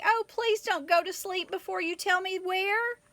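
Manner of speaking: put-on pleading young kangaroo. A narrator's reading. Katy begs the owl.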